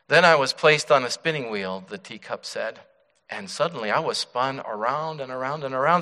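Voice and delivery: loud, high, vivid parable telling; dramatic storytelling. Acted. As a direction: tell the teacup story with full drama. Dramatic parable told in sermon.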